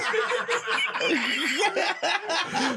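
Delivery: high, drawn-out laugh; high cackling laugh. Real, not acted. Laughing at the product placement joke. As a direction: cackle at your own joke.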